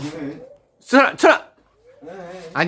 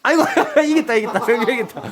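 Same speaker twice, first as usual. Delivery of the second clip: high, laughing joy at the win; laughing cheer; 4 semitones higher, faster than usual. Real, not acted. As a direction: laugh out the victory. Dad cheers son's win. (웃으며 기뻐하는 환호)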